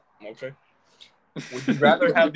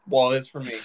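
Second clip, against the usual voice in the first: usual speaking voice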